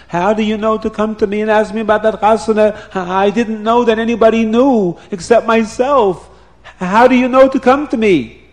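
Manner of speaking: agitated. Acted. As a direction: press her how she knew.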